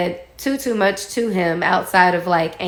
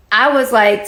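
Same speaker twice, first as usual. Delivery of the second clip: ordinary talk, nothing marked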